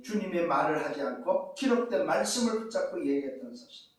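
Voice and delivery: ordinary delivery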